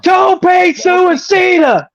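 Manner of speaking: very high, slow, stretched-out shout; mock announcer yell. Co-host joins in mocking the announcer, drawn-out yell. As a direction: stretch the move name into a hammed-up cry. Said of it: "he just yells out the name of the move".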